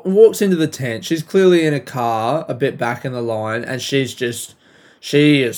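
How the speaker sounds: ordinary talk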